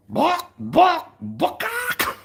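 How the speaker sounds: very high, loud chicken clucking; mock chicken call; chicken noise. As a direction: squawk like a drunk chicken. Second chicken call at 3am.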